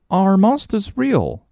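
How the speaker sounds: put-on high, curious child voice; curious girl. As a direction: ask it as a wide-eyed little girl. Child Nelly voiced in story.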